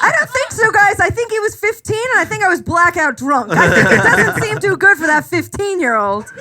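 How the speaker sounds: high, giggly denial; giggling mock protest; laughing protest. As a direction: laugh it off in disbelief. Her laughing protest to friends.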